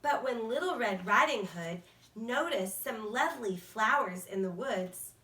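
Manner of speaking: plain reading voice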